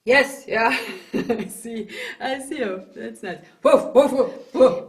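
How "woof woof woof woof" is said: loud short woofs; big dog bark. Shown as a demonstration. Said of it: "Like a big dog".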